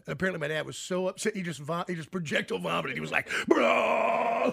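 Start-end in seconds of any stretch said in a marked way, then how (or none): 3.5-4.5 mock shout. Dad's retold shock.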